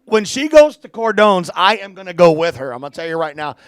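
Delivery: excited. Real, not acted. Excited about favourite restaurant.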